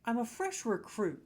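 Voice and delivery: usual speaking voice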